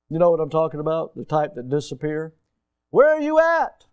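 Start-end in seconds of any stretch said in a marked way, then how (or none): 2.9-3.9 high, loud call across the yard; calling out for worker. Acted. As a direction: shout for a worker who disappeared. Boss calling for lazy worker.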